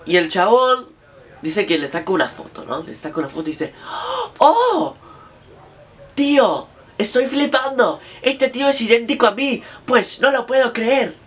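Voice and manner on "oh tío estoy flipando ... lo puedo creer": put-on much higher, Spanish accent, amazed; Spanish tourist freaking out, higher; mock astonishment. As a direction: gasp then gush in a fake Castilian accent, pitched up. Spanish man astonished at double.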